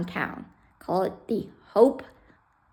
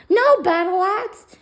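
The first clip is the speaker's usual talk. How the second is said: higher, slow put-on officer voice; mock the commandant's stern order, high and slow; 11 semitones higher, 7 dB louder, slower than usual. Lieutenant quoting the commandant, high slow mock officer voice.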